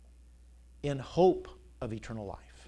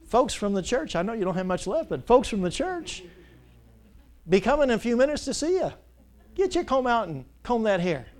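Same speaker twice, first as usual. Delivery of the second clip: mock scolding a dead man; 7 semitones higher, 3 dB louder than usual. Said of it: "I look at him and say".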